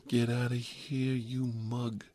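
mock street-tough bravado, stretched out; Bowery Boys tough kid. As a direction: imitate an East Side movie kid. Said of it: "like one of the Bowery Boys".

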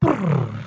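low falling lip-trill noise; motor-like brrr sliding downward; imitated brain spinning out. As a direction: let the engine sputter down. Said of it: "and then my brain went".

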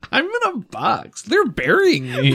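high, slow mock-dramatic wail. GM mocking the player's text in a hammed-up wail. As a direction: ham up the tragedy of being buried.

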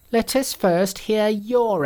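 high, bright monkey king voice. A narrator's reading.

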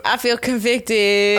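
slow, solemn mock confession; mock convicted. Cohost jokes the verse convicts her, slow mock solemn. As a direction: drag it out like a guilty sinner.

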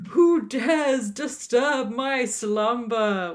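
slow, loud, booming dragon voice. Retold or imitated. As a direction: boom it out slowly like a dragon. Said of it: "one of my favourite lines".

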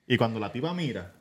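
ordinary talk, nothing marked

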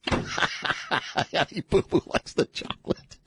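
slow, breathy words through laughter; can barely get it out for laughing. Real, not acted. Repeating co-host's joke, cracking up.

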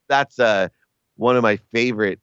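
ordinary talk, nothing marked

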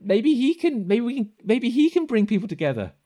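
put-on high-pitched, hesitant child voice; earnest kid. Kid vouching for his dad as leader, high and hesitant. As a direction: think aloud as a hopeful high-voiced kid. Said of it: "The kid".

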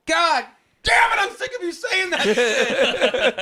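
high, loud, slow fed-up shout; half-laughing ranting yell; mock furious outburst. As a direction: explode at the annoying friend, hammed up. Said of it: "and I'm like".

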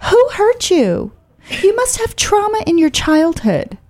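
high, condescending parting jab; mock shout. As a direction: imitate the smug Marin insult. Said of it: "she shouts after me".

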